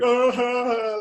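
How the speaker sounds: loud, high mock wail; acted loud sobbing cry; demonstrated open crying. Shown as a demonstration. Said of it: "I can cry like".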